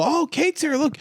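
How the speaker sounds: high-pitched mock excitement; pretend-excited party guest; excited announcement. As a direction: perk up high as if spotting someone arrive. Players greet Kate's entrance.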